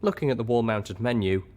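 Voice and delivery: ordinary delivery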